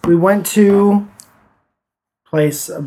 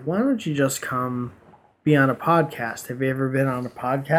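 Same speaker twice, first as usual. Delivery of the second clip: quiet coaxing; 3 semitones lower, 7 dB quieter than usual. Retold or imitated. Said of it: "and I was like".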